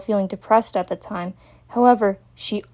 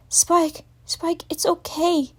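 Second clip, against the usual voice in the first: soothing; 9 semitones higher, slower than usual. Acted. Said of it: "tried to calm him down".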